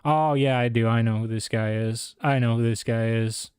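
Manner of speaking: ordinary talk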